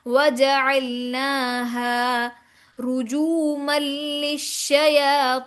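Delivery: slow chanted tajweed demo; sustained, level chant; Quranic recitation. Shown as a demonstration. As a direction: recite in measured syllables with long vowels.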